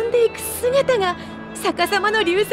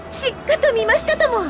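eager confirmation; 8 semitones higher than usual. Acted. Eagerly confirms having witnessed it.

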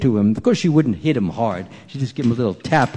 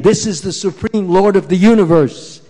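plain reading voice

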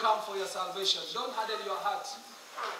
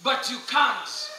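loud, raised preacher's voice, drawn-out phrases; fervent preaching; 6 semitones higher, 7 dB louder than usual. Acted. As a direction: preach it with full force and authority. Preaches at full force to the congregation.

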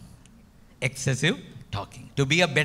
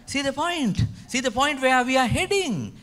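high rhetorical question; 10 semitones higher than usual. Acted. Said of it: "rhetorical challenge to listeners".

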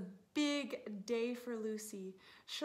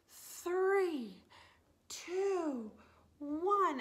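higher, louder count; excited countdown; 7 semitones higher, 4 dB louder, much slower than usual. Acted. Reading to kids, an excited race countdown.

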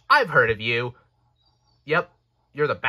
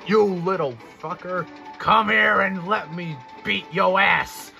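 threatening challenge; 5 semitones higher than usual. A narrator's reading. Steamroller threatens the engine.

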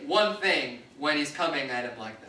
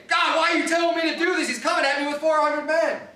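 higher and louder, a fast imitated angry protest to God; mock angry complaint; 7 semitones higher, 3 dB louder than usual. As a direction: play an indignant Jacob demanding answers. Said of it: "Does he get angry and say".

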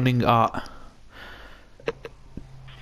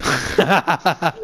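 normal talking voice

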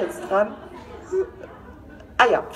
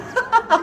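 sudden high-pitched real giggle; high giggle burst; 11 semitones higher than usual. Real, not acted. Reader losing it over the text, high giggle. Reader cracks up mid-reading.

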